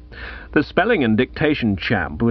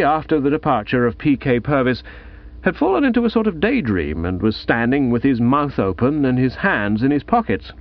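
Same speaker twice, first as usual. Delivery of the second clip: ordinary delivery, nothing marked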